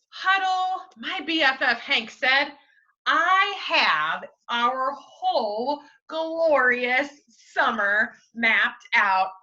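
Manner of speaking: slow, expressive read-aloud; story narration. Acted. Teacher reading the class a storybook, slow and lively. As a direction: read it like a storybook to little kids.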